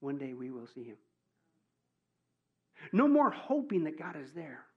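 ordinary talk, nothing marked

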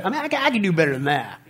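usual speaking voice

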